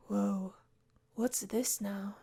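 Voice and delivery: ordinary talk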